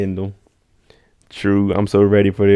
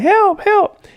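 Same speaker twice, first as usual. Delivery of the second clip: high, loud mock cry of help, much higher; 3 dB louder than usual. Girlfriend begging for help after the stabbing, high mock panic. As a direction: play the crying girl screaming for help. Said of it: "She's over here crying like".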